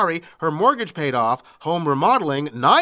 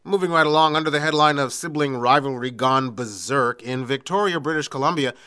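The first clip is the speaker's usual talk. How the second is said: ordinary talk, nothing marked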